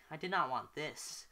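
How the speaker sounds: plain reading voice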